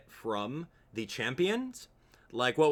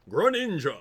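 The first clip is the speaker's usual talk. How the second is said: higher, louder game-announcer call; Smash Bros announcer; 6 semitones higher, 5 dB louder than usual. Retold or imitated. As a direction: announce the fighter's name, punched out. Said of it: "the Smash Bros announcer goes".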